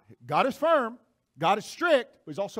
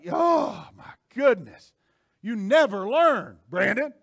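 slow, loud, drawn-out exasperation; exasperated scolding; 3 semitones higher, 6 dB louder, slower than usual. Acted. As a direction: let out a big fed-up groan of words. Playing exasperated God scolding him.

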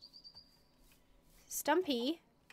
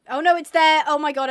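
sudden realisation; 3 semitones higher, 11 dB louder than usual. Real, not acted. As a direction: blurt it out loudly on spotting it. Suddenly spots the lost lead.

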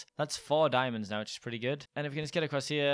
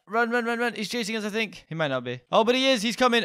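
urgent high run run; panicked fleeing, voice up; being chased; 8 semitones higher, 4 dB louder than usual. Real, not acted.

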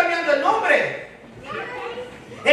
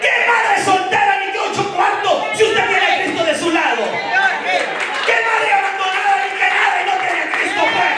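fiery preaching; 5 semitones higher than usual. Acted. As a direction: call out to the women in the pews. Preacher urging the women.

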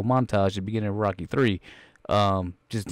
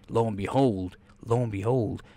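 normal talking voice

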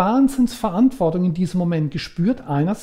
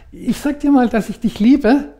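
put-on higher and louder, like a small needy child; little child inside; 6 semitones higher, 6 dB louder than usual. Inner child confessing love while longing for mother, childlike and higher. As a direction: voice the hurt little boy begging for love. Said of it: "dass da drin ein kleines Kind ist, das sagt".

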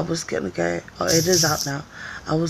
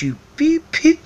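mock hostile guy; 12 semitones higher, 6 dB louder than usual. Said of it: "he gave me that look like that".

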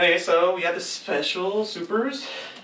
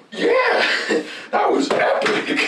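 excited cheer; 5 dB louder, much slower than usual. Retold or imitated. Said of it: "be like".

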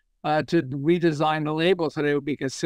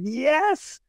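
1 delighted yes; 10 semitones higher than usual. Retold or imitated. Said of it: "started laughing hysterically and said".

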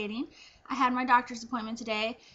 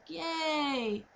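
half-hearted cheer; 3 semitones higher, 6 dB quieter than usual. Retold or imitated. Retold cheer despite her dismay.

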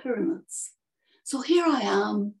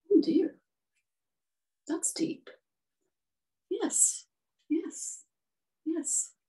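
high, soft little bird; sympathetic swallow; 6 semitones higher, 4 dB quieter, slower than usual. Acted. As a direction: murmur sympathy in a small, high voice. Swallow reacts to prince's sorrow.

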